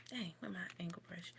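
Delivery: whispered aside to herself; fast little whisper under her breath. Real, not acted. Aside while searching makeup.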